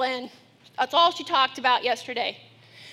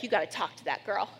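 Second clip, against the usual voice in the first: muttered aside; 8 semitones lower, 8 dB quieter, faster than usual. Retold or imitated. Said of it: "looking at me like".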